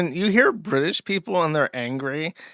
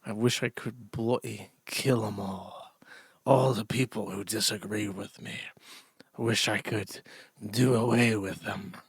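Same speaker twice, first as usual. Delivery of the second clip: mock hateful inner voice; 6 semitones lower, 8 dB quieter than usual. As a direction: play the seething villain in his head, quietly. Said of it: "where he's thinking".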